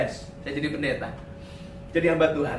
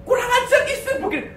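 high-pitched comic indignation; mock outrage. Husband fuming at his wife over bland Indomie, mock outrage. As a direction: blurt it out in exaggerated outrage.

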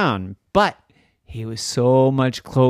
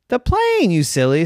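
teasing; 5 semitones higher, 6 dB louder than usual. Acted. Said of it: "said the sister".

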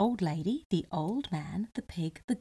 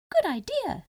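gingerbread man voice; 12 semitones higher, 4 dB louder than usual. Acted.

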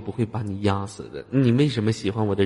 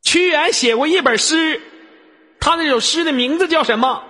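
mock quizmaster; 6 dB louder than usual. Mock quiz master voice.

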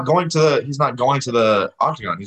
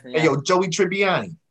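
higher New York greeting, leaning on Joey; mock New York accent; 6 semitones higher than usual. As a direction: hail him like a New York wise guy. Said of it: "New York accent".

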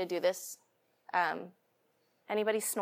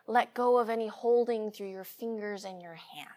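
soft spa whisper; 5 dB quieter than usual. Acted. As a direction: ease them gently into stillness. Leads into the relaxation.

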